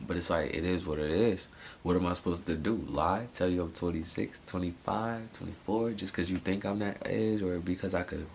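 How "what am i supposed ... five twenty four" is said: ordinary delivery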